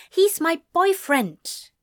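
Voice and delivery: high, loud, slow possessive retort; sharp retort. A narrator's reading. Girlfriend staking her claim to Anthony, sharp retort. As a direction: shoot it back, pitched right up and drawn out. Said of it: "Clara shot back".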